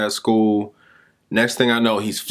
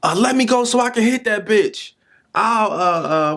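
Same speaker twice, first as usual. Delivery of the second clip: imitating a screaming kid; 11 semitones higher than usual. Teacher retelling a furious boy's cursing, high mock yell. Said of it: "He was like".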